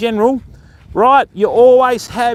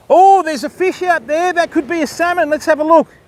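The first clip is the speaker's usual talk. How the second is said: high-pitched burst of excitement; excited; 8 semitones higher than usual. Real, not acted. Angler spotting a possible salmon, high and excited. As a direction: react eagerly, pitched well up. Spots a fish out there.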